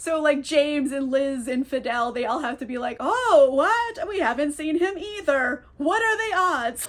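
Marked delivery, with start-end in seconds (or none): 3.0-6.9 high, loud hammed-up surprise; overplayed innocent shock; fake surprise. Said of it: "Play acting they don't know".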